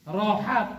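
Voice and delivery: ordinary talk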